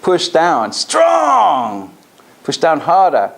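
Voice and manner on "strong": high, firm, ringing declaration; a powerful, commanding call of strong; strong tonality. Shown as a demonstration. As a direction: push the word out with force. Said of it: "Strong tonality".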